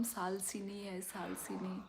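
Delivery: whispered name, no voice. Real, not acted. Whispering so her sleeping daughter won't wake. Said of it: "इसलिए मैं धीरे बता रही हूँ".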